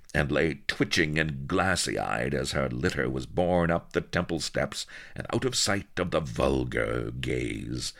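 ordinary delivery, nothing marked

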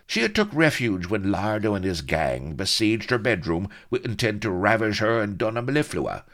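bad-tempered account. A narrator's reading. Blood reporting the siege to Avery, bad-tempered. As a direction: rattle out the sordid tale crossly. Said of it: "seemed to be in a bad temper".

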